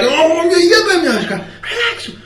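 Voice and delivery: mock upset outburst, breaking into laughter. Retelling how the upset guy reacted, high mock upset. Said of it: "the guy was so upset".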